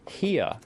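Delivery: "here" stretched into two syllables; two-syllable pronunciation. Shown as a demonstration. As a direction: say "here" as "hee-ah". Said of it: "is a word with two syllables".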